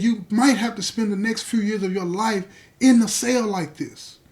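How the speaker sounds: a broken father pressing his son, rising to full force; father's anguished plea. Retold or imitated. Father begging his son in the cell, swelling anguished plea.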